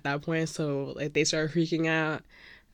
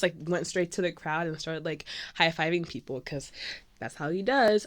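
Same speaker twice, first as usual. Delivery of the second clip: ordinary talk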